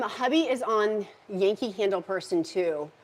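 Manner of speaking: ordinary talk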